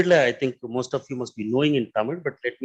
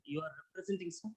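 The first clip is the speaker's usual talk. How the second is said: mic handed over; 18 dB quieter than usual. Real, not acted. Speaker without mic, faint.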